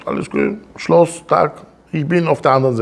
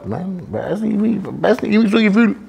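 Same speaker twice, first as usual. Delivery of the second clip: higher, hesitant whine of a sulking person; mock moping reply; 7 semitones higher, faster than usual. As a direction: play the listless guy who doesn't know why. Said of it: "Wenn du ihn fragst". (gespielt lustlos und zögernd, höher)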